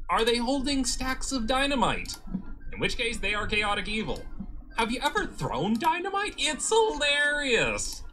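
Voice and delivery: high, gleeful cackling excitement; giddy chaotic evil joy; manic glee. Acted.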